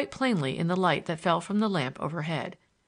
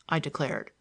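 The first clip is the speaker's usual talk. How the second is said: ordinary delivery, nothing marked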